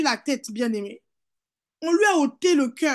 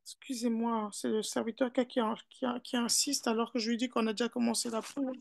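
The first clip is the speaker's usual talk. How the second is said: softer, low-key apology; quiet aside; 2 semitones lower, 9 dB quieter, faster than usual. Real, not acted. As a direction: drop out of preaching for a hushed explanation. Aside about an interruption. (aparté à voix basse)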